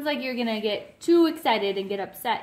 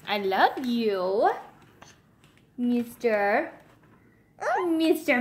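usual speaking voice

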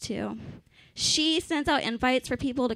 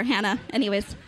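normal talking voice

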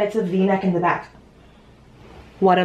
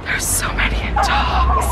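excited whisper-shout. Real, not acted. Thrilled at seeing dogs.

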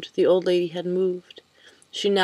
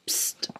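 a sharp breathy psst, barely any voice; whispered psst; 7 dB quieter than usual. A narrator's reading. Old lady hissing at Harold across the church, whispered psst. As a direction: hiss to catch his attention in church.

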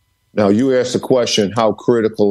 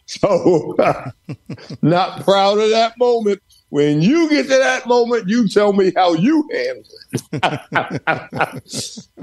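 laughing through words; 8 semitones higher than usual. Real, not acted. Laughing at his own story.

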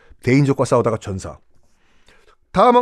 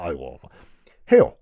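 ordinary talk

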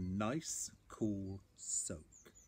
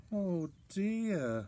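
higher-pitched storybook sigh of dismay; 8 semitones higher than usual. A narrator's reading. Bear after his first sneeze, sighed dismay. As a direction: sigh it out in a raised voice. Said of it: "sighed Bear".

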